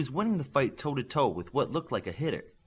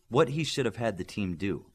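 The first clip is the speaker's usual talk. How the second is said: ordinary delivery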